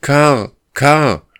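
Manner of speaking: loud, high call of his name; a loud, sorrowful call. Acted. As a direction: call him from far ahead. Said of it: "rief er nach einer Weile".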